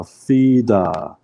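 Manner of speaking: "fida" slowly, syllable by syllable; sounding out. Shown as a demonstration. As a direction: spell out "fida" with drawn vowels. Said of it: "So we say".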